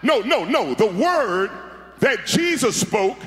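normal talking voice

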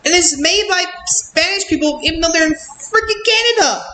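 indignant disbelief. Real, not acted. Reviewer ranting that the show is from Canada, indignant disbelief. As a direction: squeal the last word in disbelief.

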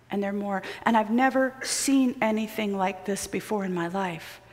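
usual speaking voice

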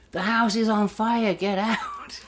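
high, loud alarm shout; retold yelling. Someone running around outside, shouting the house is on fire. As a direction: bellow the warning up the stairs. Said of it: "someone running around going like".

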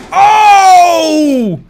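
long, loud, high yell of delight; excited shout. Real, not acted. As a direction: let out a big triumphant yell. Big moment in the match.

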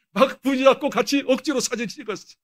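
ordinary talk

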